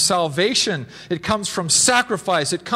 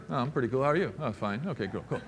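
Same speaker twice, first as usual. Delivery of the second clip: low, much softer, bored small talk; lukewarm greeting; 7 semitones lower, 8 dB quieter, faster than usual. Retold or imitated. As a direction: sound dull and indifferent. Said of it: "Would that be better than".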